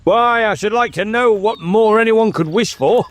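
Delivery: high, patronizing king; tiresome grown-up condescension. Acted. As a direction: talk down to a small boy, pitched high. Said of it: "talking in the tiresome way".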